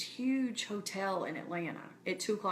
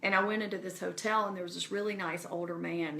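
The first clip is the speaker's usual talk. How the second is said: ordinary talk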